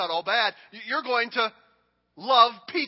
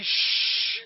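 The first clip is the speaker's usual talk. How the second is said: whispered shh; 11 semitones higher than usual. Shown as a demonstration. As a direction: hush her gently. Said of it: "Just say".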